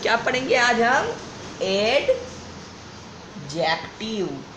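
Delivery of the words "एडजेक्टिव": एडजेक्टिव stretched very slowly, broken into parts; एडजेक्टिव dragged out piece by piece; drawn-out syllables. Shown as a demonstration. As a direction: sound out एडजेक्टिव slowly, pausing between its syllables. Sounding out the word while writing.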